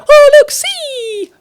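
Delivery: very high, slow squeal. Retold or imitated. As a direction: squeal the words out slowly. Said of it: "you know the one where you go".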